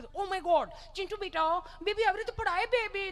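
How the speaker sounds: high-pitched, gushing mock alarm; hammed-up high baby-talk concern; fussing mother. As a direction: imitate an over-anxious modern mum. Fussing mother to fallen child, acted.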